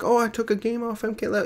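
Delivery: high, mock-chipper brag; mock proud player. Streamer imitating a player proud of a win in a high voice. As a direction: play a pleased player sharing his win. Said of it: "You can be like".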